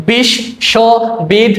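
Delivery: বিশ্ববিদ্যালয় broken into its five syllables, slowly; slow syllable-split reading for students. Shown as a demonstration. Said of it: "যদি আমরা ভেঙে ভেঙে বলি".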